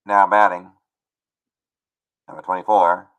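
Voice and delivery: loud, measured ballpark PA call; stadium announcer. Shown as a demonstration. Announcer introducing the next batter, stadium PA voice. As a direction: call the batter with long pauses between phrases. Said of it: "And then I would say".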